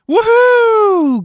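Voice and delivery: very high, loud whoop; cheer. Real, not acted. Streamer whoops at chat after the race ends. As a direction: whoop in triumph. Celebrating the finish.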